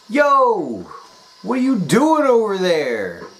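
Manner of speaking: high, loud yelled call from across the street; cook yelling across. Retold or imitated. Cook yelling from across the street, high and loud. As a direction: holler it across the street at him. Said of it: "He yells over to me".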